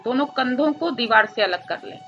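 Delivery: ordinary delivery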